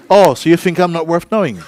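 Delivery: loud, high indignant comeback; hammed-up wounded retort; mock offence. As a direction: act out taking offence, loudly. Acted offended comeback in anecdote.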